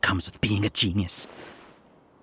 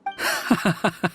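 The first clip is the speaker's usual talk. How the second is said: short high-pitched laugh; scoffing laugh; 10 semitones higher than usual. Acted. Beaten schemer reacting to his rival's praise, scoffing laugh. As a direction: laugh it off in brief disbelief.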